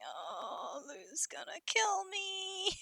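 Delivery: frightened, slow whisper; panicked whisper. Retold or imitated. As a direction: whisper it like you're doomed. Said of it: "It's just like".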